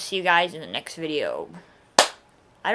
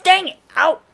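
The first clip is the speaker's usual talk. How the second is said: pained yelp; 12 semitones higher, 13 dB louder than usual. Retold or imitated. Retold pain at the dentist.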